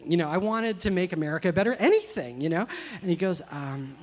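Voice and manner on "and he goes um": normal talking voice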